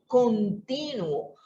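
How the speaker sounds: "continuo" drawn out slowly; stressed word. Shown as a demonstration. Preacher making the congregation hear "continuo", slow and stressed. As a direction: stretch "continuo" so it sinks in.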